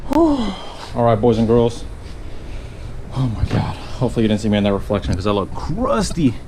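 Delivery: out of breath from the heat; panting between words; heavy breathing. Real, not acted. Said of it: "Sorry for the heavy breathing".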